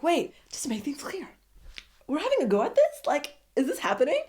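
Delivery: double-check with eager surprise; mock excited host. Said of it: "So he's like".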